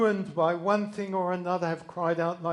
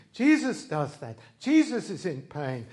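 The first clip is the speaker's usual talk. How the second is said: ordinary delivery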